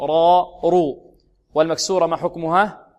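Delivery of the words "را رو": را and رو said loud and heavy. Shown as a demonstration. Teacher demonstrating heavy ra, loudly. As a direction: boom the heavy را. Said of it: "تفخيم".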